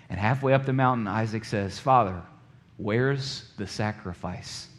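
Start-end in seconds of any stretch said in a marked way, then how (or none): 1.8-4.7 put-on quiet, slow boy's question; hushed and slow, a son asking his father; soft question. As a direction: ask it gently, a little lost. Isaac asks his father softly.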